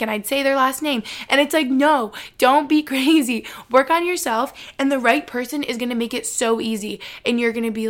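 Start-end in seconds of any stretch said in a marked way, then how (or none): none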